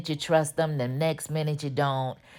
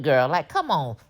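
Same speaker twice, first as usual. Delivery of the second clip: ordinary talk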